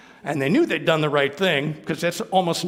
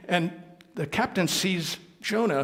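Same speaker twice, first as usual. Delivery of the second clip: usual speaking voice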